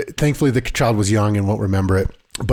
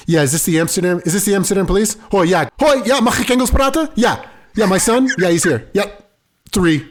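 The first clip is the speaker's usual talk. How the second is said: fake phone call; 7 semitones higher than usual. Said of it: "I did a fake phone call".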